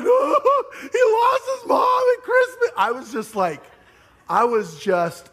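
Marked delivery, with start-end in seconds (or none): 0.0-2.7 very high, slow, loud mock sob; hammed-up wailing. Pastor acting out wailing to his wife, hammed-up sobbing. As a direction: wail it like a heartbroken kid. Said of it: "I'm wailing".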